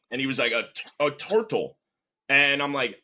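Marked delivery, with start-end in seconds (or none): 0.5-1.8 put-on Russian accent, stumbling then repeating "turtle"; imitated Russian kid accent; thick Russian accent. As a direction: repeat the word patiently in a heavy Russian accent. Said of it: "With, like, a thick Russian accent".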